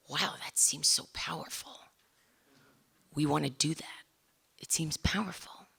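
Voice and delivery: soft, half-whispered wonder; retold onlookers' hushed amazement; hushed awe.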